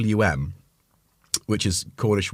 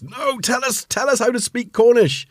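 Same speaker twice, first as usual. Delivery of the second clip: much higher, mock-desperate outburst; pleading crowd; 4 dB louder than usual. Acting out people begging the last Cornish speakers, pleading. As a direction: plead in a high put-on voice, building louder.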